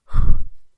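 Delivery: big unvoiced exhale; loud sigh. Real, not acted. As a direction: let out a heavy sigh. Loud sigh before segment.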